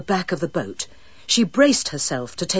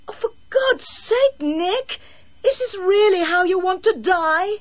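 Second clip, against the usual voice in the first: desperate pleading; 12 semitones higher than usual. A narrator's reading. Desperate woman pleading with boy.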